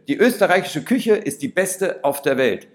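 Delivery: loud, high toast; proud shout of praise; rousing cheer. Acted. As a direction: cheer it out to the whole forest. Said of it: "Ich rufe".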